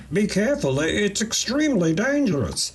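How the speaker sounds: squeaky anxious alien warning. Acted. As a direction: alien assistant warning the worker, high and anxious. Alien Floss warns Leno.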